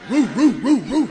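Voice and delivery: loud barking roo roo; big dog bark. Pretend. Preacher playing the big dog for the congregation, loud barking. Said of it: "Don't I sound like a big dog?".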